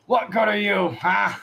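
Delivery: high, loud taunting shout. Acted. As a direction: yell it over the dead bandit. Bandit killer taunts the corpse.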